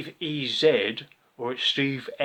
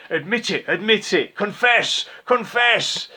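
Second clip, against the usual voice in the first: high, loud mock interrogator chant; mocking the kid's demand for a confession, higher and louder; mocking him; 9 semitones higher, 6 dB louder than usual. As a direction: ham up a Spanish Inquisition demand. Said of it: "I started mocking him like this".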